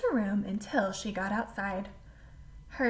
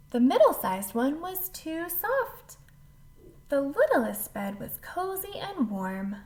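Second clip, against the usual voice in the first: plain reading voice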